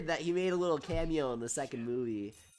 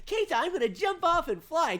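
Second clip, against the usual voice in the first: high, loud cutesy cat voice; excited high cat announcing a jump; eager high cartoon imitation; 11 semitones higher, 5 dB louder than usual. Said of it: "he's like".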